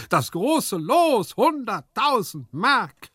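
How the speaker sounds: very high, loud, drawn-out outcry; exclaiming servant. A narrator's reading. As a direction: call out each word in amazement at the money. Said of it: "rief Hyacinth". (laut und hoch gerufen, gedehnt)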